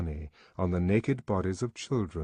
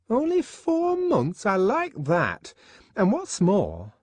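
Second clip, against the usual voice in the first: high, slow, drawn-out indignant woman; 11 semitones higher, 4 dB louder, slower than usual. Acted. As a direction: pour on the shocked disbelief. Fanny scandalised.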